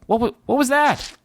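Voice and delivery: high-pitched, startled double take; startled question. Acted. Kravitz turns round after the flare, startled. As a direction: blurt it out, caught off guard.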